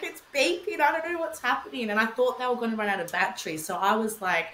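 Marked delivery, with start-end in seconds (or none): 0.0-1.9 much higher, fast panicked rush; panicked phone call. Retold or imitated. Mother phoning Pat about the beeping monitor, much higher panic. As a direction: gabble it out in alarm to your partner. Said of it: "I just freaked out".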